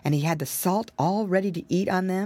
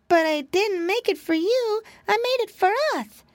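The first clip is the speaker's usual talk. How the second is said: indignant piglet; 14 semitones higher than usual. Acted. Piggy boy objecting.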